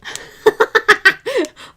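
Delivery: high, loud burst of laughter; real cackling laugh. Real, not acted. Laughs at the pen remark.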